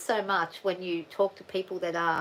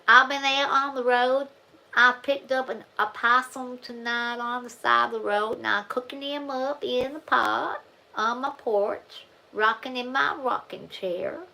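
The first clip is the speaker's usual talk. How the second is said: louder, slow Tennessee drawl as the lady from chat; Tennessee accent; 5 semitones higher, 5 dB louder, slower than usual. Retold or imitated. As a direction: imitate a Tennessee storyteller, dragging out the vowels. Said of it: "she spoke like that".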